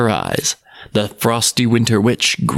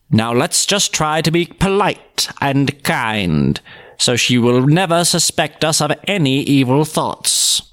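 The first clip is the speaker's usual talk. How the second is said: lightly voiced sly witch. Witch plotting.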